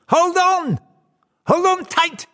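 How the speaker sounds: high, loud shouted warning; old man's cry. A narrator's reading. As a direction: cry out as a little old man, far up in pitch. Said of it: "cries the little old man".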